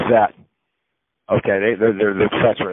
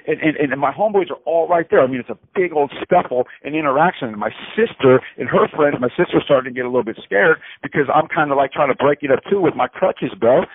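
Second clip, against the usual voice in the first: normal talking voice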